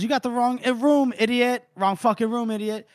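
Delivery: high-pitched annoyed retort, retold; mock irritation. Woman yelling off a door-knocker, mock irritated.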